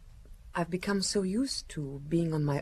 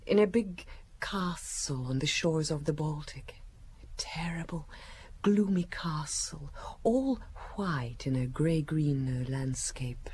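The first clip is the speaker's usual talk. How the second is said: hushed whisper. Acted. Woman telling the inspector of her childhood castle in a hushed whisper. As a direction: let the memory come out in a whisper.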